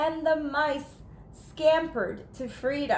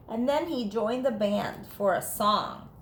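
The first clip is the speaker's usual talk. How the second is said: usual speaking voice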